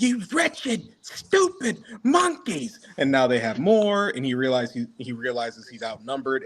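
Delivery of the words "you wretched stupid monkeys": high, slow sneering villain voice; high put-on tyrant voice with a laugh; Frieza's sneer. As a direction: drag out the insult like a cruel tyrant. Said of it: "And he's like".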